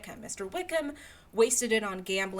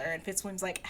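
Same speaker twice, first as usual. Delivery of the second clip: usual speaking voice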